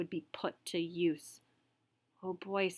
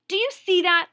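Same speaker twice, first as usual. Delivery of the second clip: high, loud demand; angry demand; 10 semitones higher, 10 dB louder than usual. Acted. Teacher pressing the boy about the spitball, high loud demand. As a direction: insist he answer now. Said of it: "the teacher demanded of Gregory again".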